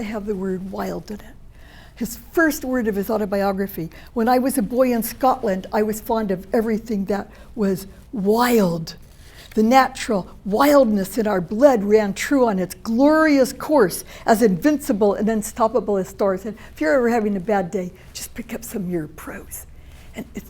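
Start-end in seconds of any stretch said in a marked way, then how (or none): 4.1-16.4 dramatic reading. Acted. Reads Muir's autobiography aloud. 18.1-20.4 whisper. Real, not acted. Whispered aside to audience.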